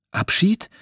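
Abschied asked high with a rising, astonished tune; a girl's high, surprised Abschied?; surprised question. A narrator's reading. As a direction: echo Abschied as a startled question. Madeline echoes, surprised.

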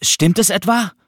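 put-on boy running into the hall, excitedly calling out. A narrator's reading. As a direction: burst in breathless with the news. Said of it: "rief er aufgeregt".

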